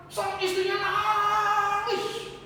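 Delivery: high, very slow, stretched-out telling; drawn-out mock wail. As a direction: stretch the crying word out high. Dramatising the wife's crying. (meratap dibuat-buat, tinggi dan panjang)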